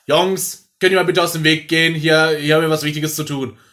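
loud, self-important request; officious calling out. Acted. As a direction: shoo the boys out of the way, loudly. Spricht Jungs an, die im Weg stehen. (laut und wichtigtuerisch gerufen)

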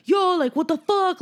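imitating him with high, loud attitude; mad attitude. Imitating the guy confronting Lou, mad attitude. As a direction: come at him with a high-pitched challenge. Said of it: "giving him mad attitude".

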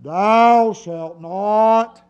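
very slow, drawn-out solemn command; voice of God. Acted. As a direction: stretch each word like a divine decree. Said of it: "when God starts saying".

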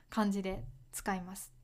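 ordinary talk

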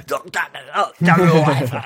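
a hint of a stammering soldier; hammed-up cruel retort; mock soldier reply. Acting out the killer replying.

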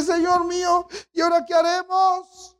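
high, pleading cry of panic; frightened servant crying out to his master; anguished servant. Acted. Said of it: "se mete angustiado y le dice".

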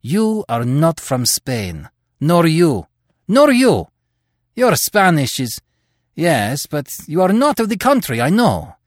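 angry accusation. Acted.

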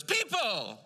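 high, loud call of people; wife greeting newcomers eagerly, a high loud call; excited call. Retold or imitated. Wife greeting the newcomers, high loud excited call. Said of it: "she goes".

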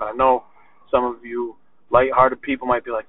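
ordinary delivery

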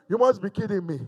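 usual speaking voice

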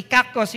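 kakos, said with relish as a nasty sound; nasty-sounding Greek word. Shown as a demonstration. Preacher savors the Greek word kakos, nasty-sounding. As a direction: spit out "kakos" as an ugly word. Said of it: "Even the sound itself is so nasty".

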